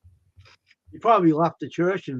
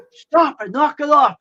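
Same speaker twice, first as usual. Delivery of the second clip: higher and louder, a firm mock command; retold shout; 8 semitones higher, 7 dB louder, slower than usual. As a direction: bark it like breaking up a fight. Retold man pulling partner away.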